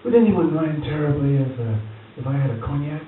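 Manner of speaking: imitating Lawrence's genteel request; posh old actor. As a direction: imitate a frail grand old thespian asking politely. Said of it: "he'd say".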